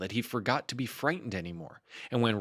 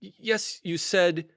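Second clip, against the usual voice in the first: higher, hesitant stammer; a touch of flustered stammer; stammering Pooh; 8 semitones higher, 6 dB louder than usual. Acted. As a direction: trip over the first word nervously.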